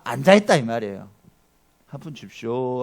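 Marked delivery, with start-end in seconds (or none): 1.9-2.8 low, soft beggar's plea; subdued beggar voice; begging for coins. Acted. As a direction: beg feebly from the roadside. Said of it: "하고 앉아있는 거예요".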